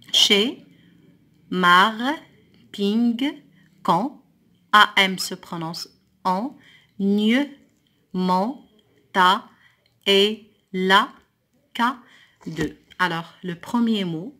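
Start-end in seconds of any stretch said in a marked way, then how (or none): 0.1-4.2 each syllable alone, louder, with pauses; syllable-by-syllable reading. Shown as a demonstration. Teacher reading syllables to pupils, syllable by syllable. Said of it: "je lis les syllabes". 7.0-12.8 isolated syllables with a pause after each; syllable-by-syllable reading. Shown as a demonstration. Teacher reading the exercise's syllables, one at a time. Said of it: "je lis les syllabes".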